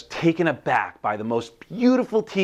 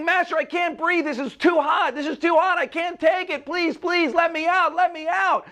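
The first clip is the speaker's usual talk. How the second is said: very high, frantic begging; screaming plea. A narrator's reading. Teacup in the hotter oven, frantic high screamed plea. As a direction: cry out in panic to be let out. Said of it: "And I kept screaming".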